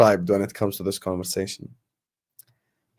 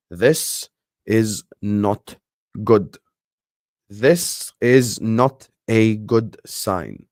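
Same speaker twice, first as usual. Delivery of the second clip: slow grave verdict; much slower than usual. Real, not acted.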